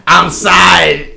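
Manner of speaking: loud mock drill-sergeant apology, drawn out; Major Payne. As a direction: bark it slowly like a movie major. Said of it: "Major Payne".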